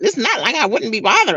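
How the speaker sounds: normal talking voice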